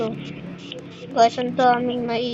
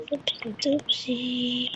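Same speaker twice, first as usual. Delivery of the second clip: whispering; 9 dB quieter, slower than usual. Acted. Repeated playfully, whispered.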